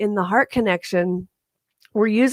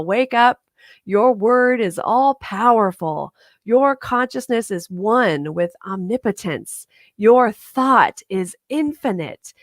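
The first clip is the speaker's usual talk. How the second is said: rousing preaching; 3 semitones higher, 3 dB louder than usual. Acted. Minister closing her talk to the congregation, rousing preaching. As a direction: preach it out to wake them up.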